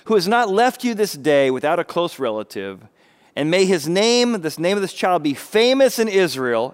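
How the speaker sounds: maidservants blessing. Retold or imitated. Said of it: "these are the maidservants talking to her".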